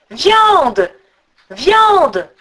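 loud, high, falling calls; eagle's cry. A narrator's reading. Eagle calls to its rider for food, loud swooping cry. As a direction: cry it out twice, long and swooping. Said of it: "l'aigle qui criait toujours".

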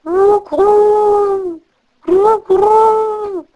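coucou très aigu, chanté et descendant; roucoulement de pigeon. Acted. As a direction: imitez un pigeon qui roucoule. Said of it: "les pigeons de la forêt dirent". (pigeons de la forêt à Gerda, roucoulement aigu)

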